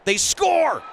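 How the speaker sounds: high, loud goal call; goal call shout. Real, not acted. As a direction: yell the goal with pure excitement. Goal call in hockey broadcast.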